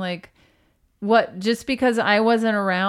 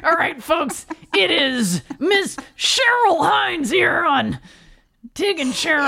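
announcer voice; 9 semitones higher, 5 dB louder than usual. Acted. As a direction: introduce the show grandly. Mock host introduction.

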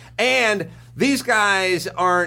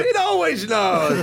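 Toucan Sam voice; 7 semitones higher than usual. Pretend. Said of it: "like Toucan Sam".

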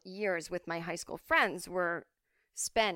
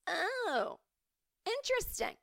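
much higher put-on voice; naive child; 14 semitones higher, slower than usual. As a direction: sound wide-eyed and naive. Mock naive reply to friend.

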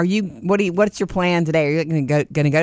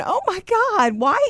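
distressed; 14 semitones higher than usual. Retold or imitated. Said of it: "calling Murphy on the way home going".

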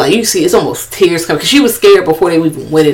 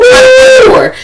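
high, loud mimicked call; mock urgent; 6 dB louder than usual. Voicing friends urging him to the door, high loud mimicry. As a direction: call it out in a squeal, imitating.

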